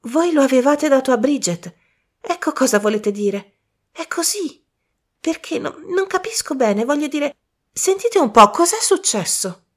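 high, breathless and tumbling; gasping to catch up with the idea. Acted. Michael, out of breath, presses Poirot for the answer. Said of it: "disse Michael con il fiato mozzo".

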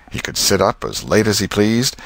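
ordinary delivery, nothing marked

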